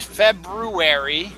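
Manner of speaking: February slowed to FEB-ROO-ery. Shown as a demonstration. Host confirming the R, slow FEB-ROO-ery for February. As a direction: draw out the "broo" in February. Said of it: "yes, there is an R in it".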